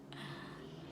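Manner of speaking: long breathy sigh; exasperated sigh. Real, not acted. Mother recalling a stranded night, exasperated sigh. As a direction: let out a weary breath.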